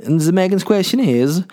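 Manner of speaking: announcing. Real, not acted. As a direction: present the question with a flourish.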